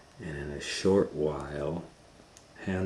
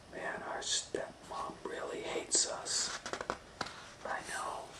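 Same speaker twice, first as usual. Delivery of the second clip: put-on children whispering secretly; two scared kids whispering; 8 dB quieter than usual. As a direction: hush it so the stepmom can't hear. Children whisper to each other.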